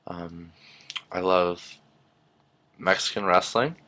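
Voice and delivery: ordinary delivery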